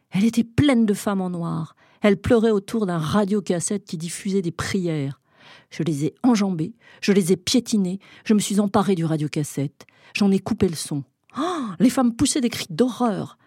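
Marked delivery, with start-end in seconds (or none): none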